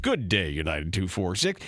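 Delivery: low, clipped cockpit sign-off; pilot cockpit headset. Retold or imitated. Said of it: "you get to hear all the jargon".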